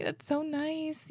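moved almost to tears; slow tender murmur, on the verge of crying; touched, near tears. Real, not acted. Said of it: "I'm gonna cry".

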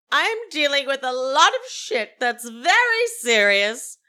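high, loud mock outrage; Meredith. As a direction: rant like a grand diva storming out. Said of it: "as Meredith is walking out".

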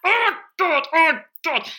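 high, loud despairing oh God; exasperated groan. Retold or imitated. Mother cutting fiddly carrots, exasperated high wail. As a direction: moan it in mock despair over tiny carrots.

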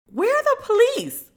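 very high, baffled where are the police; incredulous squeak. Acted. Comic asking where the police went, high and incredulous. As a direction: squeak it in disbelief.

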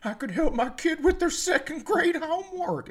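high, whiny helpless parent voice; mock helpless parent. Parent pretending they cannot help with homework, whiny. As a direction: whine like a parent pretending to be dumb. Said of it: "people walk around like".

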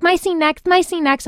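high, pushy mock kid voice; mocking the eager young ones; impatient classmates. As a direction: nag like eager young actors. Eager classmates wanting next scene.

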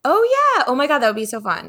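high delighted burst; light up at the idea; high thrilled exclamation; excited. Real, not acted. Reacting to a fun idea.

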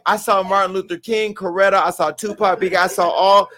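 ordinary talk, nothing marked

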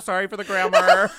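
laughing mock apology. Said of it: "I'm like".

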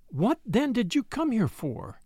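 high desperate plea, slowing at the end; cornered widow, desperate. A narrator's reading. Widow demanding the stranger's purpose, desperately. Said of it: "said the widow desperately".

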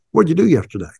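a touch of the interrogating coach; questioning coach. Retold or imitated. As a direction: put the question pointedly, as a coach confronting a player. Retelling coach's stern questioning.

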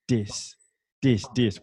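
this said as dis, a d in place of th, repeated; kid saying dis. Retold or imitated. Boy points at his shoes, saying dis. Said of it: "he couldn't pronounce the T H".

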